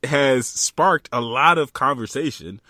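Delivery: giddy restart after the pun; amused. Real, not acted. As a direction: carry on through giggles. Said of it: "Y'all like how I did that?".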